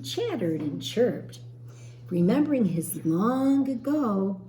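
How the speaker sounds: put-on lively storybook reading for children; teacher reading a story to young children, gently expressive; story narration. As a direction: read it like a picture book aloud to a class.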